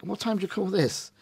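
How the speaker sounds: scolding spouse. Retold or imitated. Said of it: "tells him off as though somehow it's his other half's come home".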